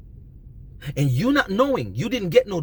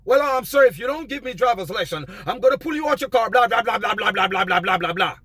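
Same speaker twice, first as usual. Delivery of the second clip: mock cop threat; 4 semitones higher, 3 dB louder than usual. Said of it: "And let him answer".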